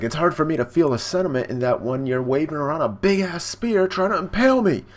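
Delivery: retold heated comeback; indignant retort. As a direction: act out indignation, building to a loud high finish. Sanji retorts in acted quarrel.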